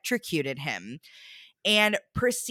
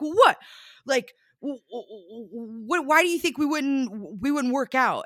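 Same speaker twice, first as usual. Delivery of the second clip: high, louder flustered imitation; indignant stammer; 5 semitones higher, 3 dB louder than usual.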